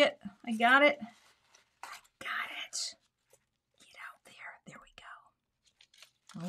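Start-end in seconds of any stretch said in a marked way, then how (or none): 1.8-6.5 soft unvoiced whisper. Real, not acted. Crafter whispering while pulling out the tie.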